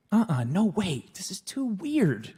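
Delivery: put-on a sulky teenager recoiling at the request; teenage protest. Teen son refuses to lie down.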